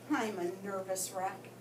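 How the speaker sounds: usual speaking voice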